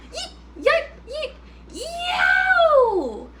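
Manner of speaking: very high yelping howl, loud; coyote yelp. Acted. Coyote burned by the stove fire, high loud yelps. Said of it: "all those sounds together".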